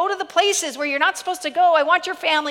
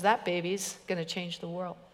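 much lower, soft and grave; sudden sober quiet; 7 semitones lower, 10 dB quieter than usual. Retold or imitated. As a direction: say it low, dead serious. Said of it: "he looks at me stone cold sober".

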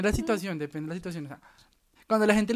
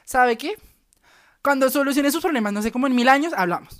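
sassy brush-off; 6 semitones higher, 6 dB louder than usual. Retold or imitated. Mock brush-off speech.

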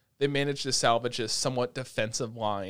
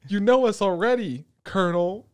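higher, louder character quote; voiced anime character; 7 semitones higher, 6 dB louder than usual. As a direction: lightly voice a character addressing the colonel. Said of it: "It's like".

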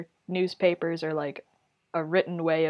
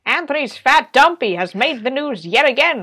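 loud, high proclaiming crier voice; town crier; 7 semitones higher, 8 dB louder than usual. Pretend. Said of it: "goes around yelling".

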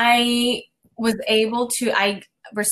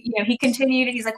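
ordinary delivery

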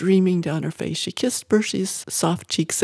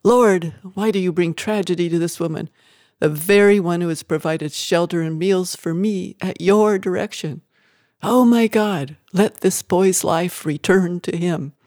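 plain reading voice

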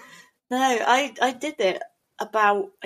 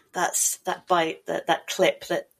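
normal talking voice

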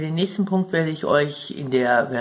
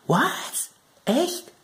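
high, squeaky "What? Echt?"; mock astonishment; 12 semitones higher, much slower than usual. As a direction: play up shocked disbelief. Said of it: "Soll ich sagen".

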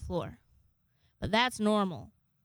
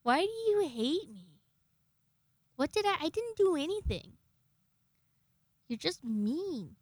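mock-wounded plea, swooping higher in the middle; retold hurt protest; 5 semitones higher than usual. Owner asks hissing cat why it hates them, plaintive. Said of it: "I'm like".

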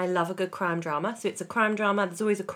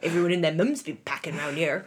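broad West Country accent, played up. To her co-host, showing how people talk back home in a broad West Country accent. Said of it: "that's how people talk in the West Country".

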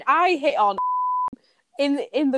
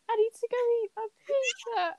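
high, soft mock-tearful whimper; imitating crying friend; 10 semitones higher, 6 dB quieter than usual. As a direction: whimper it small and high, mimicking her. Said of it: "like, crying on me".

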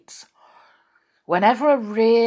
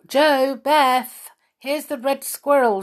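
put-on calling the children in from the house, louder and higher; 7 semitones higher, 5 dB louder than usual. A narrator's reading. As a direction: call them in loudly. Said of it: "she called".

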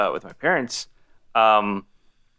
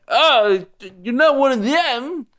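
much higher, louder, super exaggerated American accent mimicking Hans Gruber; exaggerated fake American accent; 13 semitones higher, 4 dB louder, slower than usual. Podcaster mimicking Hans Gruber's fake American accent, hammed-up and high. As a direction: ham up a German villain's fake American accent, pitched way up. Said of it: "like, super exaggerated".

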